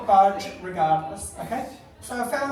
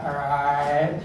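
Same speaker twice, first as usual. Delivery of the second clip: low, lightly voiced surly London cashier; 7 semitones lower than usual. London cashier greeting a customer, low surly imitation. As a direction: grunt it low, a hint of surliness. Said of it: "She'd be like".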